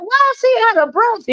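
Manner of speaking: high, mock-incredulous question; mocking disbelief. To the co-host, mocking the winded rival, high mock disbelief. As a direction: sound amazed that he's winded. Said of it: "I'm like".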